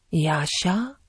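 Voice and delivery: put-on soft, questioning old man; lightly voiced frail grandfather, softly asking; a hint of a dying old man; weak grandfather. A narrator's reading.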